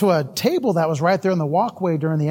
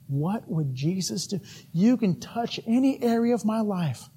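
hushed prayer; 6 dB quieter than usual. Acted. Prayer voiced in sermon.

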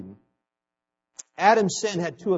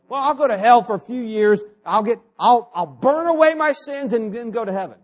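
mock casual excuse, higher and louder; 假想的轻率辩解; 5 semitones higher, 5 dB louder than usual. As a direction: imitate someone breezily reasoning it away. Voices a mistaken believer, then rebuts.

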